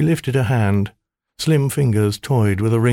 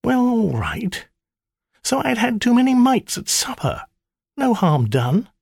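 much higher, grudging confession voice; sheepish maiden. Acted. Spirit admitting the night's antics to her master, sheepish. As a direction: own up sheepishly.

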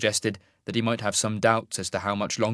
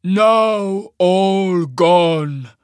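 loud, very slow giant's lament; mournful rumbling giant; 8 semitones higher, 9 dB louder, much slower than usual. A narrator's reading. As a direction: draw out each word in booming grief. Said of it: "stared mournfully at its fallen comrades".

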